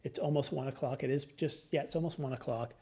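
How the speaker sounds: normal talking voice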